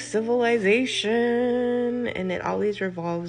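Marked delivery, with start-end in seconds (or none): 0.0-2.1 slow, higher and louder, sending up the fairy tale dream; sarcastic sing-song mockery; mocking. Real, not acted. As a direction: drawl it out as if it's a silly fantasy. Mocking Disney fairy tale tropes.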